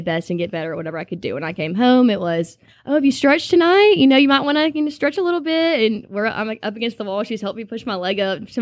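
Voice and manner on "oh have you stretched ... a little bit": sweetly nudging her to stretch; mom nagging. Retold or imitated.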